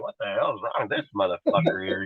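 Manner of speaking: low, hushed bewildered remark; mock confidential aside. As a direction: imitate onlookers muttering to each other. Onlookers commenting to each other.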